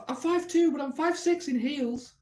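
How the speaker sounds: squeaky put-on woman answering his question; mock woman's voice. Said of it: "She goes".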